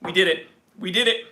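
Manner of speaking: normal talking voice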